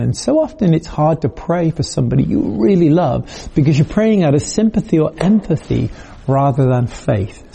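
ordinary talk, nothing marked

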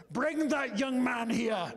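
a slow call from upstairs, lightly deepened; booming old man. Retold or imitated. Preacher voicing an old evangelist calling down the stairs, slow and lightly booming. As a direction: slowly summon the visitor like an old evangelist. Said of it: "this booming voice comes from upstairs".

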